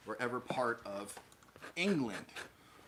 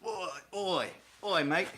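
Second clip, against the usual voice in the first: put-on young British street-talk accent, pitched up; British youth accent; 6 semitones higher than usual. Imitating young Brits on a stream, British youth accent. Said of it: "that young person talking from".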